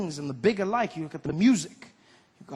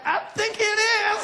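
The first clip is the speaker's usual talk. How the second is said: flattered squeak. Retold or imitated. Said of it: "you'll be like".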